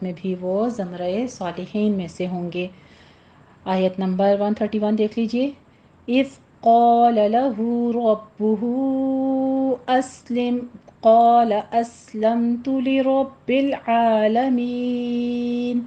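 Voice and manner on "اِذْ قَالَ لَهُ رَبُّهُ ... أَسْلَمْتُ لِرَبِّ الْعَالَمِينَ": slow, measured tajweed chant; Quran recitation. Shown as a demonstration. Teacher recites verse one thirty-one for the class, chanting. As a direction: recite the verse slowly and melodically.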